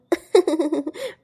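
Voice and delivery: soft scheming chuckle; a quiet villainous laugh to herself. Acted. Scheming woman laughs alone.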